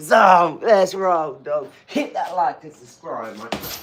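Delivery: wife mimics his sign-off with a loud jeering shout; mocking shout. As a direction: belt it out to tease him. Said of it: "So she goes".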